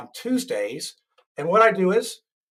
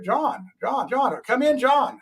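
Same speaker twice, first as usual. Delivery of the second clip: higher-pitched call to a guest; 8 semitones higher, slower than usual. Real, not acted. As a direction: call him in over a link. Calling a remote guest on air.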